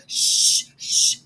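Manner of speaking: drawn-out voiceless shush. Retold or imitated. Girl silencing her screaming sister, urgent shushing. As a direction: hush someone urgently.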